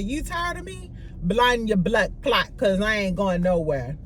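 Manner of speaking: put-on Jamaican accent. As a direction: imitate a Jamaican accent, drawing out the curse. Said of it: "That was my accent".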